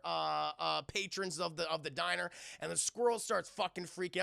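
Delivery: ordinary talk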